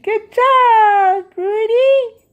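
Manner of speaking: high, loud sing-song praise for a pet; praising the dog. Real, not acted. Owner praising her dog for using the pee pad, high and loud pet voice.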